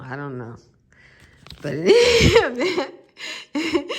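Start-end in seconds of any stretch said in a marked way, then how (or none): 1.6-3.9 real laugh breaking into the words; louder, high-pitched, laughing through the phrase. Real, not acted. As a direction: crack up halfway through and push on. Laughs at own tomato speculation.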